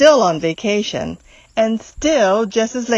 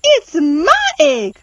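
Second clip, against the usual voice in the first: higher, slow indignant sputter; 7 semitones higher, 4 dB louder, slower than usual. Acted. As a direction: splutter in outrage. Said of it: "she sputtered".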